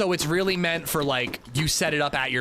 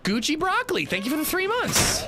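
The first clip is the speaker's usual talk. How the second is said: excited thanks; 13 semitones higher than usual. Real, not acted. Thanking a subscriber.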